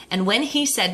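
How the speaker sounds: ordinary delivery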